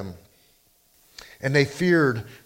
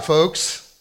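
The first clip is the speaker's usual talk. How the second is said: ordinary delivery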